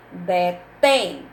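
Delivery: Detém with a loud, held final TÉM; stress on last syllable. Shown as a demonstration. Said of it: "É a sílaba que soa mais forte".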